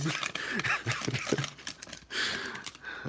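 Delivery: long, mostly voiceless laugh. Real, not acted. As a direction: keep laughing at your own blunder. Laughs at own misreading.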